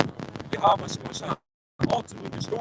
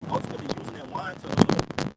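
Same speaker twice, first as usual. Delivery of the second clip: normal talking voice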